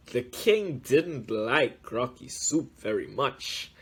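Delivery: ordinary delivery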